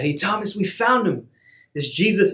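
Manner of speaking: high, eager calling out of the news; excited news. Acted. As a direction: burst in with the good news. Disciples excitedly tell Thomas.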